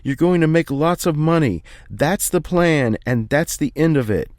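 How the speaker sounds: father roaring the plan, slowing on the last words; shouting at top of lungs. Acted. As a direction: bellow it at your son with everything. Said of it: "He shouts at the top of his lungs".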